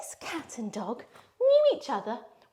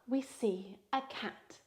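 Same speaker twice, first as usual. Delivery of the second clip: slow, much softer breathy whisper; suspense whisper; 11 dB quieter, slower than usual. Acted. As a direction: hush them as the cat appears. Story suspense on the walk.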